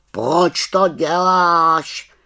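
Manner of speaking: high, loud raven croak, very drawn out; raven, questioning. A narrator's reading. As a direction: ask it as a puzzled raven, stretching the words. (protáhlý havraní hlas, tázavě)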